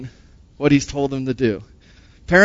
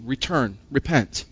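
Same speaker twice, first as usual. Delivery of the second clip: softer, with falling stress; soft, grave return and repent; quiet emphasis; 4 semitones lower, 7 dB quieter than usual. Acted. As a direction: let each word drop heavily. Gloss of the Hebrew word.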